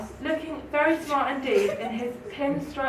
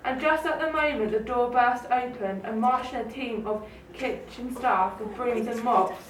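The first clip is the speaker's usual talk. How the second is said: usual speaking voice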